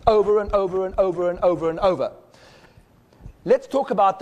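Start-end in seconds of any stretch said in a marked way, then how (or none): 0.1-2.1 hypnotic droning repetition. Shown as a demonstration. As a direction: drone it like a pendulum. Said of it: "guiding repetitious pulse".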